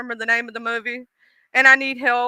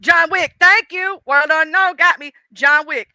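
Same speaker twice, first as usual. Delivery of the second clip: higher, louder relieved thanks; light up as the name comes back; excited gratitude to the chat; relieved excitement; 4 semitones higher, 5 dB louder than usual. Real, not acted. Viewer supplies the title.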